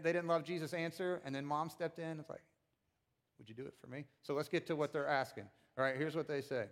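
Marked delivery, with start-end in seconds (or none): 3.4-4.0 hushed, low-key motherly request; small, quiet plea from a mom; softly coaxing mother. Acted. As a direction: ask it very softly, like coaxing a favor. Mom steps in to coax Jesus.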